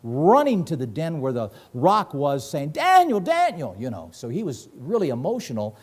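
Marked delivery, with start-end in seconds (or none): 2.7-3.8 loud high cry of a name, falling away; desperate call for Daniel. Retold or imitated. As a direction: shout the name into the den. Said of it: "screaming out for Daniel".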